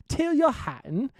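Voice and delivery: mock announcer calling Tyrrell Hatton; hammed-up name call. Shown as a demonstration.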